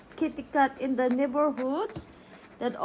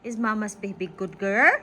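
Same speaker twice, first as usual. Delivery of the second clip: normal talking voice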